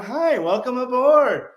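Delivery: lightly mocking friendly greeting; cheery greeter. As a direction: play an overly friendly Navy clerk.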